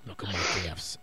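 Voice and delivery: low breathy whisper. Pretend. Whispered echo.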